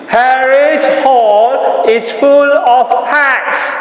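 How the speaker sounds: loud, high and slow; loudly. Shown as a demonstration. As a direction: call it out big to the class.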